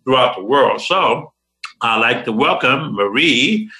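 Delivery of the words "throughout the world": ordinary talk, nothing marked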